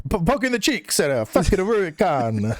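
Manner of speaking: badly faked Portuguese accent, half laughing; botched Portuguese accent. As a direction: fumble through a fake Portuguese accent. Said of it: "I was trying to".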